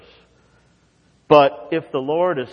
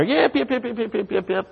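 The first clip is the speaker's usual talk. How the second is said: much higher yips of a small dog; imitated little yapping dog; 11 semitones higher than usual. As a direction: yap like a tiny dog.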